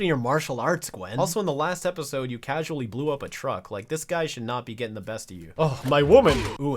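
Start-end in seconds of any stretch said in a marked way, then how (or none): 5.6-6.8 excited cheer. Real, not acted. Cheering Gwen's fight move.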